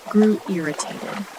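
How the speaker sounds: plain reading voice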